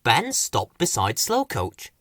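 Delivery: ordinary delivery, nothing marked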